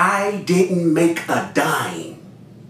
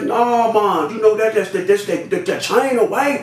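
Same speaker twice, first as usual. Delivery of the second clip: higher-pitched, stumbling protest; retold excuse; 7 semitones higher than usual. Supplier explaining away fake product, high defensive excuse. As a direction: stammer a defensive denial up high.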